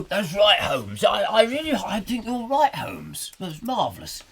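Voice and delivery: high, stammering bumbler that drops on the last word; Nigel Bruce. Acted. Watson agreeing with Holmes, high flustered admiration. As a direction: bumble in admiration, pitched well up. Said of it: "Nigel Bruce".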